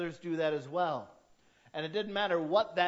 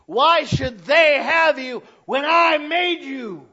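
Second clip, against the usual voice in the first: loud, high, booming possessive demand; jealous God; 8 semitones higher, 13 dB louder, slower than usual. Acted. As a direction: thunder it as a jealous God claiming his own. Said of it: "whose name is jealous".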